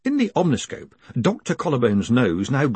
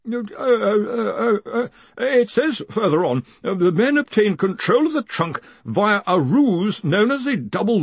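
high, stammering reader; flustered professor; 10 semitones higher than usual. A narrator's reading. Professor reads the accusation on, flustered high voice. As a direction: stumble in, then read on in a high voice.

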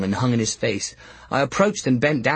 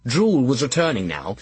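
plain reading voice

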